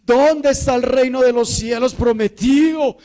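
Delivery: loud, high pleading to a father; impassioned shouted prayer; crying out to God. Acted. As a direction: call up to heaven, begging for an answer. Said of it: "así es que clamamos".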